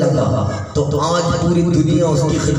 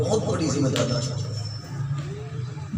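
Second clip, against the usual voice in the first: much softer, slower repeat of the line; soft, slow echo; 11 dB quieter, slower than usual. Acted. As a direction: let the repeat sink to a hush. Repeated line dropped to hush. (دھیمی، آہستہ آواز میں دہرانا)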